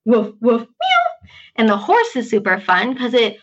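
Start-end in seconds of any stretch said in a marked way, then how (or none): 0.0-1.3 woof then meow. Shown as a demonstration. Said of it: "You combine them".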